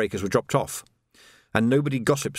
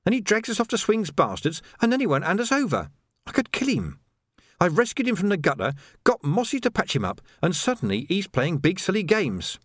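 higher, irritated landlady; snapping; 8 semitones higher than usual. A narrator's reading. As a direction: vent your frustration, clipped. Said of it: "snapped Rosie".

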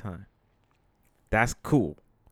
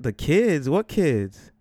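loud, startled questioning; taken aback and pressing for an answer; incredulous demand; 4 semitones higher, 6 dB louder, much slower than usual. Retold or imitated. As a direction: throw it back in loud disbelief. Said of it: "I said it like that".

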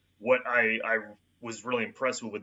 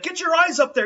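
much higher, loud frantic yell; retold scream; 9 dB louder than usual. Wounded soldier screaming at his helper, loud frantic order. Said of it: "when he's screaming".

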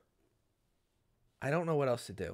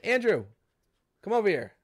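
calling across store; 5 semitones higher, 4 dB louder than usual. Retold or imitated. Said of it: "she'll say".

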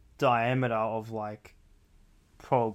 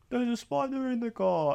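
hoge Franse moeder; French accent; 10 semitones higher than usual. Acted. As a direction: speel een Franse mama in paniek. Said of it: "French accent".

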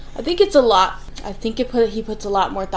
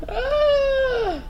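a hammed-up, much higher mock cry; fake-sob in outrage over the remark; mock wail; 3 dB louder than usual. Reacting in despair to a remark.

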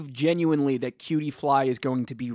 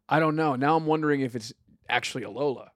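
ordinary delivery

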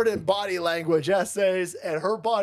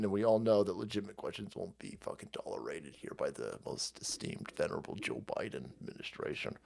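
much lower and softer sarcastic hush; hushed sarcasm; 13 semitones lower, 11 dB quieter than usual. Real, not acted. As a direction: sneer it under your breath.

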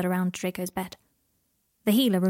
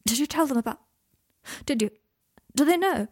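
urgent; 6 semitones higher than usual. A narrator's reading. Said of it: "said Draco urgently".

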